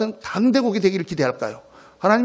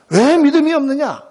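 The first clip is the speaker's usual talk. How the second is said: raised, higher-pitched scolding; stern rebuke; 6 semitones higher, 3 dB louder than usual. Retold or imitated. Preacher acting out Jesus scolding the disciples, stern rebuke. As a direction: voice Jesus's exasperated reproach. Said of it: "아주 심하게 야단치셨습니다".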